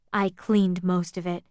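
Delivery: slowly. Acted. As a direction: drag the words out tiredly. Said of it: "he said slowly".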